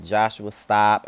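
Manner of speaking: sharp yell across the room; calling out. Real, not acted. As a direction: yell at someone to stop. Calling out to someone nearby.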